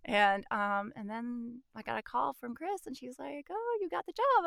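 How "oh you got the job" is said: high-pitched, lightly acted excitement; high mock-excited announcement; retold excited news. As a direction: break good news, imitating an excited caller. Retells caller announcing good news.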